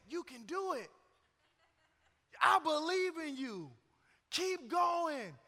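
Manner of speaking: lightly voiced lifeguard, pitched high; high, imitated pep talk; encouraging lifeguard. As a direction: urge a struggling swimmer on from poolside. Retold lifeguard cheering on a swimmer.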